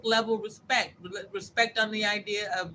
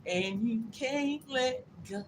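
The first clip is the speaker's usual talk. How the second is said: drawn-out mock quote; 6 semitones higher, much slower than usual. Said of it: "The lady said it".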